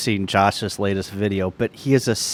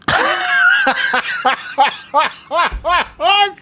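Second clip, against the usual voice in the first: high shrieking laugh. Real, not acted. Shocked by lookalike results.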